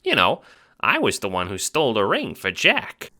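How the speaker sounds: high squeaky Italian-American chipmunk; fast-talking little mobster, squeaky; Ace, chipmunk wiseguy. Acted. Little guard talks to the Seer, squeaky wiseguy.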